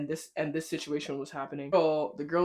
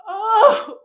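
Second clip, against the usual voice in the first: loud high shriek of surprise with a laugh; laughing shriek on learning who the man is; shocked realization; 9 dB louder than usual. Real, not acted. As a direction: shriek it as the penny drops.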